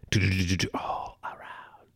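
whispered jingle slowing down; imitated ad whisper. Podcaster mimicking the commercial for his story, whisper. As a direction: whisper the slogan, stretched out.

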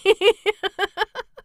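high, loud burst of giggles; laughter. Real, not acted. Reader losing it over the demure girl's line, real high giggling.

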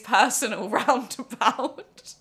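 laughing through the story. Real, not acted. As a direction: laugh while telling it.

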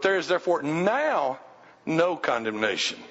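"now" stretched, then a pause; stress on NOW. Shown as a demonstration. Preacher contrasting past condemnation with the present, stressing "now". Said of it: "put emphasis on the word now".

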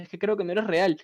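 ordinary delivery

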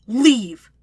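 loud, sharp one-word order; forceful, irritated command; snapped. A narrator's reading. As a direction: snap it at an idiot. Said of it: "Zuko snaps".

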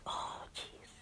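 breathless whispered oh jeez; whispered realization. Retold or imitated. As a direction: whisper it as it dawns on you.

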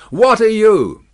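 high, loud demand; guard shouting a question back; retorted challenge. A narrator's reading. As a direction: challenge an unseen stranger. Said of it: "the guard retorted".

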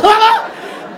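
loud, high cackle; high burst of laughter. Real, not acted. Recalling her complaint, a loud high laugh.

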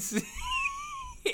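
squeaky breathless laugh; high laugh burst. Real, not acted. Vlogger to viewers, bursting into a high laugh. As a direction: cracks up high.

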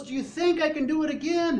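high-pitched rousing question; showman's challenge. Acted. Tightrope walker asking his cheering crowd, excited challenge. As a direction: work the crowd up. Acts Blondin rallying the crowd.

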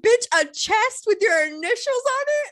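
high-pitched, hammed-up disbelief; mock incredulous scolding. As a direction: scold a friend in pretend outrage. Imagined scolding of a foolish killer.

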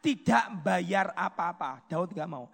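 loud, rising preacher's call; preaching. Acted. Preacher pressing the price of the altar, loud and fervent. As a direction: drive the point home to the crowd.

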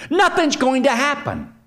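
high, mocking naive assurance; mimicked denial. Mimics Christians denying consequences.

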